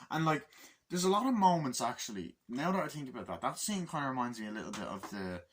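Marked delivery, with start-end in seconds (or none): none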